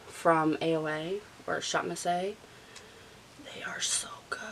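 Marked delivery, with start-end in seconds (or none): 3.4-4.4 very soft whisper; hushed, breath-only praise; whispered gushing. Real, not acted. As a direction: whisper your approval. Gushing softly over hair clips.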